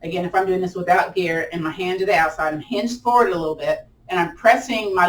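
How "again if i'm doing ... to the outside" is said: ordinary talk, nothing marked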